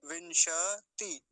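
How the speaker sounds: विंशति slowly, held on श; विंशति drawn out with a vowel after श; full श. Shown as a demonstration. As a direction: break विंशति into "vin-sha", then "ti". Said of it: "ऐसा करके".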